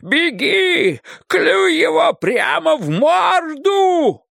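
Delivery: very high, drawn-out screamed warning; frantic desperate scream. A narrator's reading. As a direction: scream in despair for your friend to flee. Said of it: "сумасшедший крик затворника". (отчаянный сумасшедший крик)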